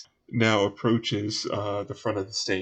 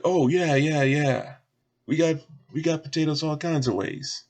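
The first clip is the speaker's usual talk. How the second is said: higher, lively bartender voice; eager bartender; 6 semitones higher, 3 dB louder than usual. Acted. Bartender answering a customer about fried potatoes, eager. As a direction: agree readily as an obliging bartender. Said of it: "It's like".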